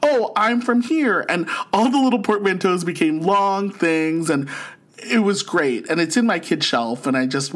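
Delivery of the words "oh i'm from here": high, drawn-out imitation of a proud child; proud kid. Kids telling class where they are from, proud. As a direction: say it slowly and brightly lifted, like proud kids. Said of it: "the kids were proud about it".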